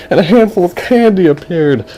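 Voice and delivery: high-pitched storytelling wonder; excited reveal. A narrator's reading. Storyteller reveals the candy, high and amazed. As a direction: lift it high for the magic reveal.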